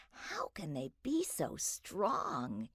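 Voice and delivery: soft, slow, wondering to himself; hushed puzzled question; a soft marveling murmur; quiet wondering. A narrator's reading. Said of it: "he wondered".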